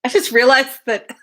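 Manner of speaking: plain reading voice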